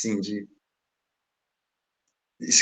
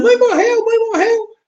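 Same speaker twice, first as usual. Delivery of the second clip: much higher, louder imitated yell; mock shouting; 5 dB louder, slower than usual. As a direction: cry out the alarm from the house. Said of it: "alguém veio gritando". (grito imitado, bem mais agudo e alto)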